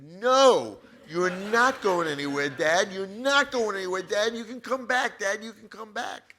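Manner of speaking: loud, high desperate cry; desperate yelling. Retold or imitated. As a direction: shout it, refusing to let him go. Yells refusal at dying father.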